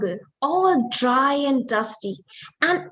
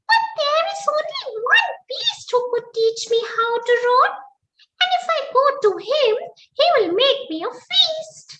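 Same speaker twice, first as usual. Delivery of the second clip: very high, fast squeaky worry; nervous mouse voice; 12 semitones higher, faster than usual. Acted. Mouse fearing the lion, high fast squeak. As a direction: rush it out high like a fretful mouse. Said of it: "Then he thought".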